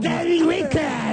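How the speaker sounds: "Daily Record" high, slow and overdone; exaggerated English. Shown as a demonstration. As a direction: ham up the English accent. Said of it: "Je peux vous le faire aussi". (« Daily Record » à l'anglaise exagérée, aigu et lent)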